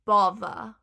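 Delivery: "bother" said "bovva", loud; Cockney th-fronting. Shown as a demonstration. Teacher breaking down the phrase, "bother" as Cockney "bovva". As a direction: punch "bother" with a V in the middle. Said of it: "that V in the middle".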